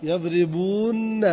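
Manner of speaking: يضربون said very slowly with the nun held; stretched final nun. Shown as a demonstration. Teacher holding the nun so students count. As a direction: draw out the nun at the end of يضربون. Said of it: "كم عندنا نونات؟".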